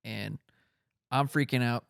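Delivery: normal talking voice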